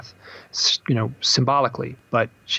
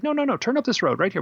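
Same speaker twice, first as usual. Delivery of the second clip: mildly urgent, higher-pitched imitation of the wife; insistent retold plea, pitched up; retold urging; 10 semitones higher, faster than usual. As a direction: urge the driver on, voice jumping high at first. Retelling wife urging husband to turn.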